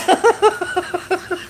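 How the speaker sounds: high squealing helpless laugh; crying laughing. Real, not acted. Streamer cracking up at a comment, helpless high laughter. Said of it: "I'm crying laughing".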